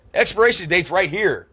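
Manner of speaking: high, loud mock-angry dad; exasperated dad. Dad pointing out the date to his son, exasperated. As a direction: mimic dad's exasperated outburst, loud and high.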